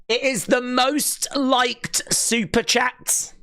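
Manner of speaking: slow, high drawn-out proclamation; amazed announcement. Real, not acted. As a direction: stretch it out high, word by word. Confirms with amazed emphasis.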